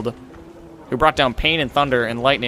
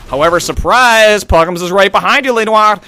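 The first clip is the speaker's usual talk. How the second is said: excited, raised call with a stretched "surprise"; live play-by-play; 4 semitones higher, 5 dB louder than usual. Real, not acted. Caster springs Puggums's ambush on viewers, excited play-by-play. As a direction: announce the sudden ambush to the viewers.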